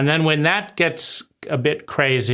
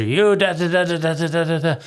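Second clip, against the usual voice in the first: mock nagging abuser; 2 semitones higher, faster than usual. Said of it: "internalized abuser".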